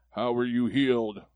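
a lightly put-on interrogator voice; questioning accusers. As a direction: grill the healed man in a slightly odd voice. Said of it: "I don't know why I'm talking like this".

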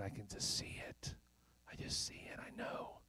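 soft, fading whisper. Acted. Believer declaring faith on the worst day, whispered. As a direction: drop right down to a hushed whisper.

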